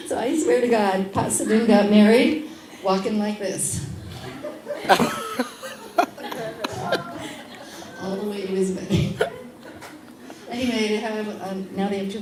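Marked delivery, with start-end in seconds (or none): 3.8-8.0 long, high, airless laugh; can't hold the giggle in; real laugh. Real, not acted. Laughs at the groom walking stiffly to his wedding, long and airless.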